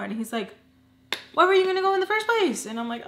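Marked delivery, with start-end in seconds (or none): 1.3-2.7 loud, high, rapid-fire mock scolding; exasperated retort. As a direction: act out an exasperated husband, pitched way up. Acting out husband's exasperated retort.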